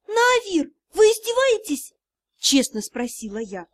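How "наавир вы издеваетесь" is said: high, incredulous question; incredulous protest. A narrator's reading. Heroine asks Наавир if he is serious, incredulous. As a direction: lift it high, as if being mocked.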